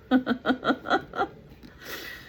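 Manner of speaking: high, airy run of chuckles; real laughter. Real, not acted. Reader amused by the ectoplasm passage, real chuckling. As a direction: chuckle at your own reading.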